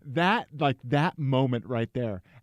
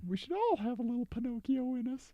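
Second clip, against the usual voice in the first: high cartoon voice; 11 semitones higher, 6 dB quieter than usual. Continues Pinocchio bit.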